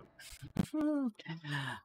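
bright high-pitched burst of laughter. Real, not acted. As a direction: laugh up high at the bad timing. Laughs after mistimed encounter.

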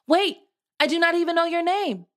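high, loud, fast calling out; calling after her. A narrator's reading. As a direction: call after her as she runs. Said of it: "called after her".

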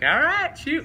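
high-pitched, loud coach exclamation; coach impression. Coach reacting to mom hanging up, in a high, loud imitation. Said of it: "and he's like".

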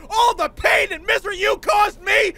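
high, loud gloating yell; gloating shout. Real, not acted. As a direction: throw it in the enemy's face. Taunting defeated enemy.

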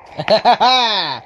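high burst of laughter; loud laugh. Real, not acted. Player laughing at the volcano chaos, high laugh. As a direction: burst out laughing, falling away.

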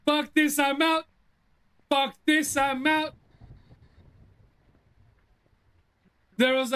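high, panicked outburst; frightened yelling. Real, not acted. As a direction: shout it while running away. Fleeing a ghost in panic.

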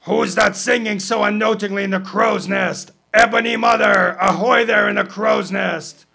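captain bellowing up at the lookout; calling aloft. Acted. Captain hails the crow's nest.

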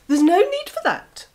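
high, loud fox voice; a quick, sharp vixen; sly fox. Acted. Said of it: "said the fox".

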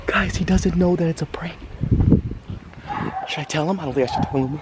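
whispered aside. Real, not acted. Conspiratorial aside to camera.

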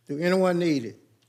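ordinary talk, nothing marked

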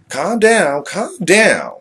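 high, loud repeated plea; shouted calm down. Acted. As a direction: yell at him to calm down. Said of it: "a conversation with ourselves".

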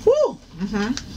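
usual speaking voice

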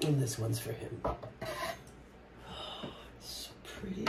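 barely holding back tears, very soft; tearful hush. Real, not acted. Dedicating her craft to her late dog, softly tearful. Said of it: "Sorry for the snifflings".

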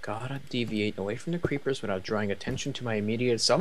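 ordinary delivery

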